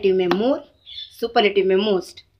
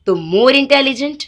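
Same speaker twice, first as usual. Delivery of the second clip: more intelligent said slowly, a bit higher and louder; emphatic slow demo; 4 semitones higher, 4 dB louder, much slower than usual. Shown as a demonstration. Teacher emphatically modelling more intelligent for students. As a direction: stretch and lift the comparative. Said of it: "देखो intelligent".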